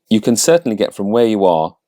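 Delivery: usual speaking voice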